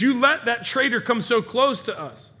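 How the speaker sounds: retold outburst of a furious king; freaking out at his men. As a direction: lay into them with indignant disbelief. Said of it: "to freak out on his people".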